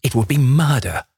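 high-pitched horrified exclamation; desperate cry. A narrator's reading. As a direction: cry out in horror, pitch leaping up. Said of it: "he cried".

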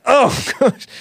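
high squeal of laughter; laughing. Real, not acted. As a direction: laugh it off, embarrassed. Laughs at being corrected again.

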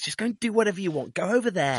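high, fast mock brush-off; a hint of an impatient crew member; dismissive film crew. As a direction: shoo them away lightly. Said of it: "they were like".

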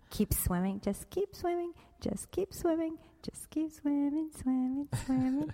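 high sing-song cartoon fish voice, way up; chirpy lilting Dory chant; Dory's cheerful keep swimming; Dory impression. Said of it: "That's Dora from Finding Nemo".

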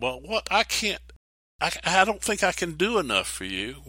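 a doubter excusing himself to God; anxious excuse. Retold or imitated. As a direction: fret that you are not enough. Voicing our excuse to God.

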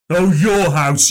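drawn-out scornful echo of "your house"; mocking sneer. A narrator's reading. Frank mocks Christopher's claim.

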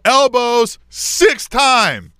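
high, slow sideline call; slow high-pitched shout of protest; re-enacted yell. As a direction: holler the running count, stretched out. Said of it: "I yell that out".

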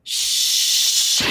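whispered shh; shushing sound. Shown as a demonstration. Listener's favorite word, shushed out. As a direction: hush someone with a breathy shh. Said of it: "is her favorite".

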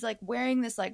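usual speaking voice

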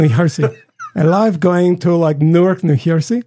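put-on Swedish-accented, J turned to H. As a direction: imitate a Swede's English. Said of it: "he went to New Jersey".

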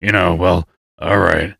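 gruff gravelly dwarf voice. Shown as a demonstration. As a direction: growl it like a dwarf. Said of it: "talked like this all the time".

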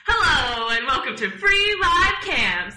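bursting through laughter; loud hype intro. Shown as a demonstration. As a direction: belt it like a show host. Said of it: "I owe you one good one".

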